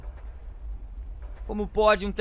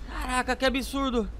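ordinary delivery

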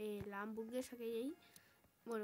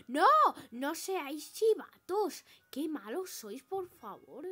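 louder, higher, slow protest; raging gamer; 6 semitones higher, 13 dB louder than usual. Acted. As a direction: yell at them not to snitch. Classmates about to snitch in game.

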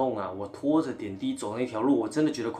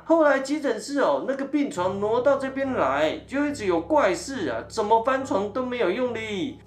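put-on a much higher, chatty older woman's voice; cleaning lady voice; 12 semitones higher, 7 dB louder than usual. Cleaning lady tells the patient about the bed, high chatty voice. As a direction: gossip like the ward's cleaning auntie. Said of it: "清洁大姐就跟我说".

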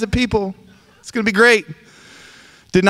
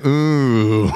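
a drawn-out, impressed ooh; imitated congregation ooh; 6 semitones lower than usual. Pastor mimicking a listener's impressed ooh. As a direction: echo the crowd's low ooh back at them. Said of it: "I heard somebody go".

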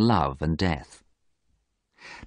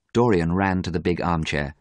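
ordinary delivery

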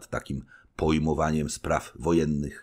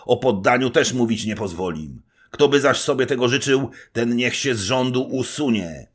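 higher, louder, threatening resolve; stern threat; 9 semitones higher, 9 dB louder than usual. A narrator's reading. Little knight warns the general, stern threatening tone. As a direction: warn them off, unbending. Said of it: "groźnie wąsikami ruszał".

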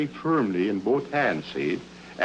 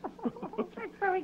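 light high chuckle; high laugh; 11 dB quieter than usual. Real, not acted. Host laughs at joke.

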